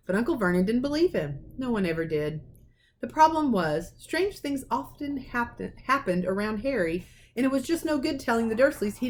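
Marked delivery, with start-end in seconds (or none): none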